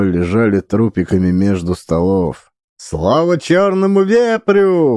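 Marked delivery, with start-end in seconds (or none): 2.8-5.0 high slow cheering cry; exclaiming. A narrator's reading. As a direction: cry out the toast. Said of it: "воскликнул Джуна".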